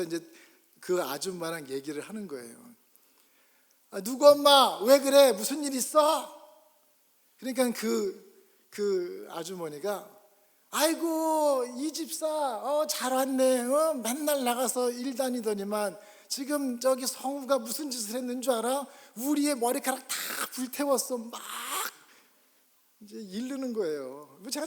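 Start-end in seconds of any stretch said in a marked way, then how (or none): 3.9-6.3 loud, higher surprised question; mother arriving home and calling out to the neighbor. Retold or imitated. 10.7-21.2 loud, high tattling wail; complaining neighbor. Retold or imitated.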